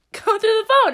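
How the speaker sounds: high-pitched imitation of an urging friend; egging her on. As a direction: goad her like a mischievous friend.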